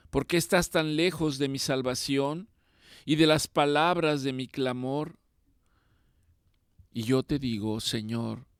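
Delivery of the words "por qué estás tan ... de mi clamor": pleading prayer. Acted. Psalm lament continued.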